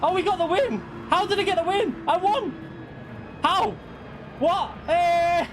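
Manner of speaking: high, disbelieving cries of joy; shocked by winning, voice soaring; surprised delight. Real, not acted. Surprise win.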